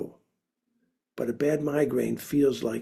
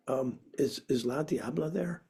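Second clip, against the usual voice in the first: timid ask; 10 dB quieter than usual. Retold or imitated. Timid boy asking for her.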